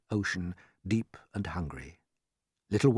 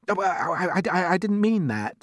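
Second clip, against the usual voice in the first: put-on stammering, pitched-up boy; hasty apology; 10 semitones higher, 5 dB louder than usual. A narrator's reading. James has offended blind earthworm, hurried and flustered. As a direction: blurt it out, tripping over the words. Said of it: "said James quickly".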